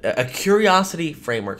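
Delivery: ordinary talk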